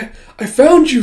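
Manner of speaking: high, loud, laughing relief; a laughing, joyful cry of discovery; relieved cry. Acted. Boy finds missing friend, bloodied.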